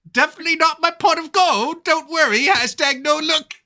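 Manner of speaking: slight Irish lilt; light Irish accent. Acted. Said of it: "I hope that was your Irish accent".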